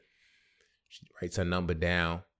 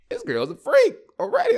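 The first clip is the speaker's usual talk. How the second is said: loud falsetto squeal; hammed-up delighted shock; thrilled disbelief; 9 dB louder than usual. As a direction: squeal it way up high. Retold thrilled reaction to dance.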